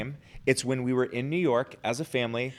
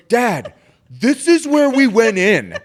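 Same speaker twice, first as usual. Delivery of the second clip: high, loud, drawn-out mock kid wail; exasperated child; 9 semitones higher, 11 dB louder, slower than usual. Father voices his child back at the park entrance, wailing in exasperation. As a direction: imitate an outraged kid calling out Dad's blunder.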